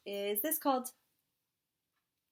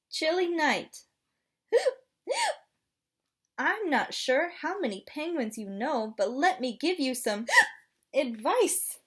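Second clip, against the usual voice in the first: hiccupy little penguin, gasping; chatty penguin with hiccups; penguin character voice; 6 semitones higher, 5 dB louder than usual. Acted.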